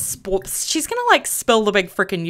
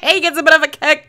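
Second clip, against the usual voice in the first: louder, higher, quick giggly retake; laughing; 5 semitones higher, 3 dB louder, faster than usual. Real, not acted. Streamer cracking up while narrating sim's rage, laughing. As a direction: say it through real laughter.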